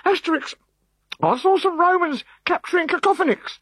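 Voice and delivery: put-on high, urgent boy's voice; a small boy blurting out bad news; high-pitched excited kid; breathless young boy. A narrator's reading. Boy Pick and Mix voiced.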